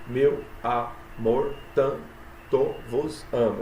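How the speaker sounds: "Meu amor, tanto vos amo" very slow, one syllable at a time. Shown as a demonstration. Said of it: "sete sílabas". ("Meu amor, tanto vos amo" escandido, sílaba por sílaba, bem devagar)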